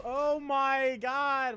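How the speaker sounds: long, drawn-out high gasp of disbelief; shocked outcry. Real, not acted. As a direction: stretch it out in horror. Shocked at old cartoon.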